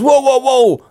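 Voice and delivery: high alarmed whoa. Retold or imitated. Robber stops him.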